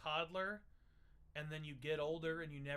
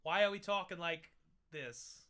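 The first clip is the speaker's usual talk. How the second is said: odd put-on voice; 7 dB louder than usual. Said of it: "Don't know what voice this is".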